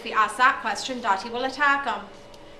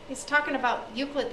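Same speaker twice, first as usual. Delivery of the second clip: usual speaking voice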